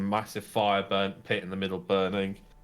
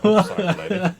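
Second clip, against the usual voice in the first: startled dwarf apology; 11 semitones higher, 10 dB louder, faster than usual. Acted. Dwarf punched by Clara.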